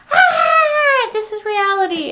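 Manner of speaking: high, loud mock baby cry; hammed-up baby wail. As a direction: wail like a newborn. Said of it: "just crying and being like".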